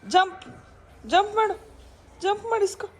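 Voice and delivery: high loud jump commands; excited commands. Real, not acted. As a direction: push the dog to jump, rising each time.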